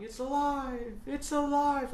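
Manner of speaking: high, loud mad scientist cry; Doctor Frankenstein. Retold or imitated. Mad scientist heard in the background, loud cry. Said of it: "Doctor Frankenstein in the background going".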